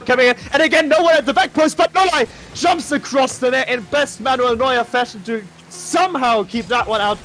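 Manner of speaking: surging play-by-play excitement; thrilled rising call; excited commentary. Real, not acted. Goal-mouth save, commentator peaks.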